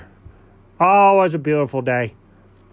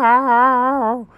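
miserable meow; 8 semitones higher than usual. Retold or imitated. Narrator imitates cat in car.

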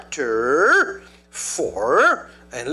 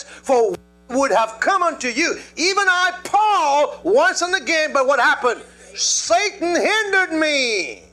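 high, loud preaching, slow on the scripture; full-force preaching; 9 semitones higher, 4 dB louder than usual. Acted. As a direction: preach it out to the congregation. Preaching to the congregation.

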